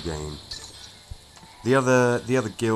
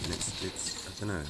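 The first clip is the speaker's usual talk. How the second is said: mumbling; 10 dB quieter than usual. Real, not acted. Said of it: "I'm, I'm mumbling".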